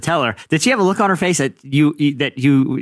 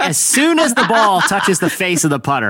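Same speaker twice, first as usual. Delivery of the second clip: usual speaking voice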